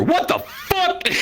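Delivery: slow, high, stretched out in disbelief; stunned groom. Retold or imitated. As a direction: drag it out, high and floored. Groom seeing bearded bride.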